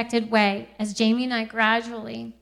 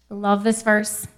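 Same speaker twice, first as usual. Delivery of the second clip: usual speaking voice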